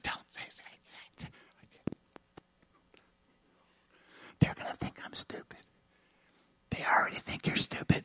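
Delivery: hushed, urgent inner voice; barely audible acted whisper. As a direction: whisper it like a private argument. Voices inner argument with the Lord.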